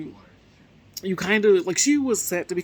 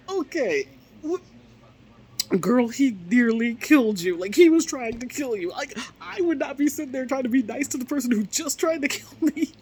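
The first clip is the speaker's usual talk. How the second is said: raised pitch, loud mock disbelief; playfully appalled; incredulous reaction; 7 semitones higher, 4 dB louder than usual. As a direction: scold a friend in high-pitched amazement.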